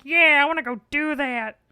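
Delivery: loud, high put-on voice; acted eager recruit. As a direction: jump at the offer in a silly voice. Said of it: "And he's like".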